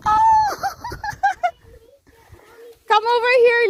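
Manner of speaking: ordinary talk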